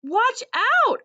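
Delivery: high, loud alarmed dog yell; barking shout. A narrator's reading. Dog barking at visitors at the door, loud alarm. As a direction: sound the alarm at the intruders. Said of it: "I bark".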